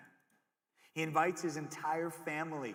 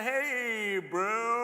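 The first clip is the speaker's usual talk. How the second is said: awkward casual greeting; 7 semitones higher, 6 dB louder than usual. Retold or imitated. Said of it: "you kinda like".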